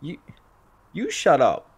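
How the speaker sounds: stumble, then throw it back loudly; upset comeback. Retold or imitated. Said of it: "got all, like, upset".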